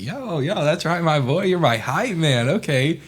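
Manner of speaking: a student celebrating his coach's praise; hyped-up swagger. Retold or imitated. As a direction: ride the hype like a proud kid.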